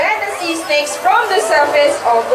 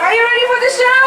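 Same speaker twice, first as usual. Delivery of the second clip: put-on overbright kids party host; 8 semitones higher, much faster than usual. Shown as a demonstration. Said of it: "Let's try this one more time".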